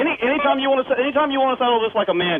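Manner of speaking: heated threat, pitched well up; worked-up, high-pitched threat; angry challenge. Real, not acted. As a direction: challenge him to a fight, voice rising. Real heated quarrel with caller.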